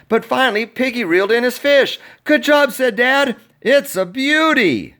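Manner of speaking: high and loud, building the chase; excited narration. A narrator's reading. Storyteller reading the fishing struggle to kids, excited. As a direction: read the struggle breathlessly excited. Action climax read excitedly.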